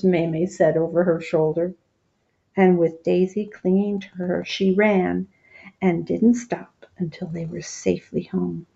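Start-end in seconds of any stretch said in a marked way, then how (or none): none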